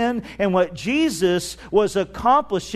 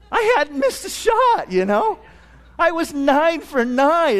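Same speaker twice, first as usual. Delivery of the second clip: indignant protest; 8 semitones higher than usual. Real, not acted. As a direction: protest it like you still can't believe it. Indignant at teammates' complaint.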